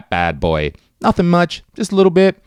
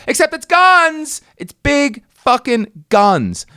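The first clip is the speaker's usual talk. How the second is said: high, slow, amazed emphasis; a touch of stunned delight; disbelieving reveal; slower than usual. Acted. Reveal of guns in glass counter.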